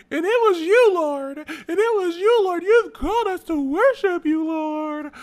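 high, loud, soaring worship-leader voice. Retold or imitated. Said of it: "it'll be more like [name]".

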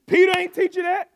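high, loud shouting coach voice; Paul as fired-up coach. Retold or imitated. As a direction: yell at Timothy like a sideline coach.

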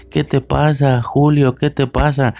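high, singsong mock child voice; hammed-up childlike questioning in high pitch; imitating movie kids. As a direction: parody the kids' line, pitched well up. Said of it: "Con ese tono, güey, te lo juro".

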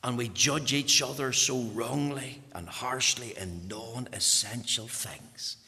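ordinary talk

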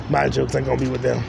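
normal talking voice

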